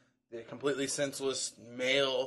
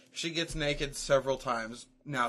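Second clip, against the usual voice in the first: usual speaking voice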